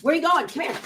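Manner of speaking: high sing-song call to the dog; high-pitched come-here call. Real, not acted. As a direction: coax the dog over in a high voice. Calling the dog over.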